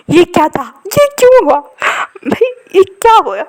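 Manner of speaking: high, shocked disbelief; bewildered. A narrator's reading. Friend left behind, shocked and baffled. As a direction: squeal out the confusion.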